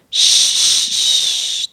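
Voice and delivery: unvoiced shushing whisper; snow shushing. A narrator's reading. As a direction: hush like falling snow. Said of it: "dit la neige". (chuchotement de la neige)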